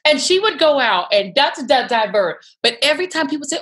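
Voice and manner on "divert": ordinary talk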